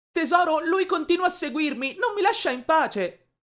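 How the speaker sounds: put-on very high, loud wailing girlfriend; whiny complaint. A narrator's reading. Girlfriend running to her boyfriend for protection, high whiny complaint. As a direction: play the wronged victim at high pitch.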